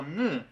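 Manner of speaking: "ng" as a nasal from the back of the mouth; velar NG sound. Shown as a demonstration. Teacher showing a complex sound, velar NG sound. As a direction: isolate "ng" with a short vowel after it. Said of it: "Esto es un sonido, eh, complejo".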